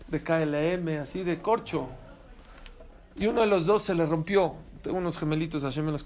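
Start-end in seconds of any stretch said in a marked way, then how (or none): none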